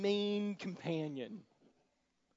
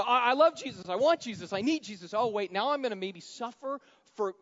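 higher, louder mock enthusiasm; 7 semitones higher, 10 dB louder than usual. Preacher mocking a believer who loves Jesus from afar, mock eager. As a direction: ham up a shallow believer's zeal. Christians' half-hearted devotion voiced.

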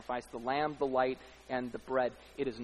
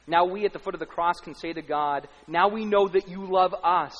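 loud swelling proclamation; preaching at full force; 4 semitones higher, 9 dB louder than usual. Acted. Preacher proclaiming God's love to the church. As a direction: proclaim it like a triumph.

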